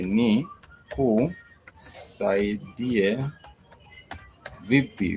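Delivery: teacher splitting the word for learners; broken into syllables. Shown as a demonstration. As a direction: break the word into its pieces. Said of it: "nikusaidie vipi".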